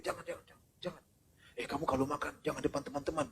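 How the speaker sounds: hushed warning. Retold or imitated. Stingy parent hushing child.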